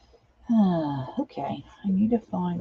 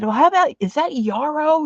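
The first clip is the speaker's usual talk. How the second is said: retold eager question; 10 semitones higher, 4 dB louder than usual. Retelling question to husband.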